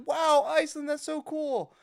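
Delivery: high-pitched imitation of an impressed host; mock-impressed announcer. Announcer reacting to a guest from Iceland, imitated mock-impressed. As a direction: gush in a put-on high voice.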